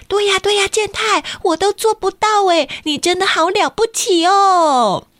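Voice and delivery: high, eager praising voice; gushing classmate. Acted. A classmate eagerly praises Kenta. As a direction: heap on the admiration.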